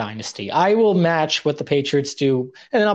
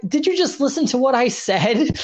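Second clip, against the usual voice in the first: much higher, incredulous retort; retold disbelief; 9 semitones higher than usual. Trainee to wrestler after repeated offer, mock incredulity. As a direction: act out exasperation at not being heard.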